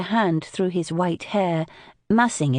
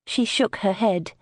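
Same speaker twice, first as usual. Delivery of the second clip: usual speaking voice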